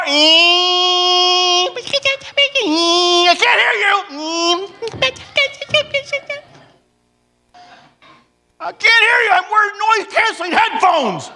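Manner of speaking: high, loud yell over the vacuum; shouting over noise. Retold or imitated. Wife shouting from the attic over the vacuum. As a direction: shout as if over a roaring machine.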